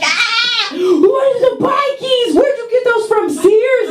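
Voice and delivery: very high, mocking shriek; shrill mocking kid voice from the subway bullies. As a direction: cackle at the new kid's sneakers. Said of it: "they're like".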